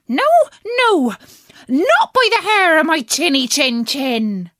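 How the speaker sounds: defiant little pig. Acted. Pig refuses the wolf.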